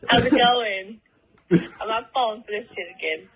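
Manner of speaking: ordinary talk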